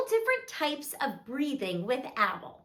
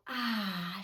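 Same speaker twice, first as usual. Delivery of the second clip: soft, low breathed-out "ah"; calming exhale; 6 semitones lower, 7 dB quieter than usual. Shown as a demonstration. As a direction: let the breath go gently. Models breathing out in story.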